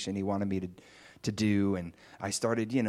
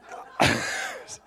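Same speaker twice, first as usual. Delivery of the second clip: short airy chuckle; breathy laugh. Real, not acted. Laughs at own story.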